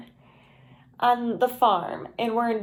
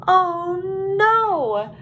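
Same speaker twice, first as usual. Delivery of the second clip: higher, louder cry of alarm; storybook shout; 7 semitones higher, 4 dB louder than usual. A narrator's reading. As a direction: cry out as a startled turkey. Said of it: "Wing shouted".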